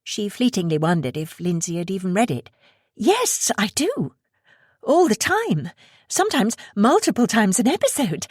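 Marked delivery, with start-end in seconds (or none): 3.0-8.3 high, rushed fangirl gushing; flustered, almost stuttering. Acted. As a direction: blurt it out in a nervous rush. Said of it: "She was almost stuttering, in a rush".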